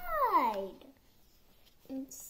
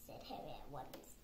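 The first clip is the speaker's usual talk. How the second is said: plain reading voice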